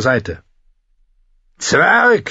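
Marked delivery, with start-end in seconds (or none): 1.6-2.3 furious high battle shout. A narrator's reading. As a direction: scream it at the dwarf. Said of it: "schrie er".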